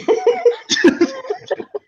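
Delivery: high squealing laugh. Real, not acted. Laughing at own joke.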